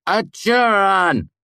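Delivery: high, loud cry bubbling with amusement; amused shout. A narrator's reading. Simon breaks into his friends' talk, amused shout. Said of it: "Simon shouted, his voice bubbling with amusement".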